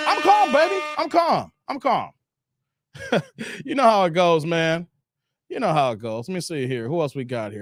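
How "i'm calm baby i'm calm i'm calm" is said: insisting he's calm. Real, not acted. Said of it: "Calm down".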